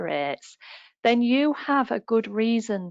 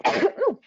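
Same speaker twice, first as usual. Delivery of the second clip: sudden sneeze; a real sneeze bursting out; sneezing; 11 semitones higher than usual. Real, not acted. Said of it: "I'm going to sneeze".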